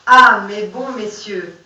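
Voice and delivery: dramatic reading of a poem. Acted. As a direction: carry the verses through to the ending. Reads poem aloud.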